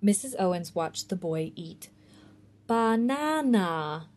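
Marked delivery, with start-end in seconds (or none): 2.7-4.1 slow, drawn-out doubtful word; dubious. Acted. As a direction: say it like a strange foreign thing. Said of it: "she said dubiously".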